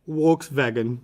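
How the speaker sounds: Volkswagen said as wolksvagen; V and W swapped. Shown as a demonstration. Teacher demos the swapped V and W. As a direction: Volkswagen with W first, then V. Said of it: "they pronounce the V as a W".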